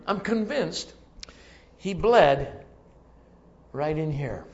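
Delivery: usual speaking voice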